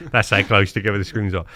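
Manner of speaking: normal talking voice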